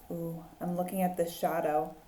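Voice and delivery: ordinary delivery, nothing marked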